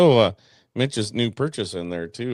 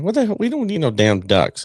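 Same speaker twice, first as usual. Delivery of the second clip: mock exasperation; 7 semitones higher, 3 dB louder than usual. Retells own protest at daughter's ducks.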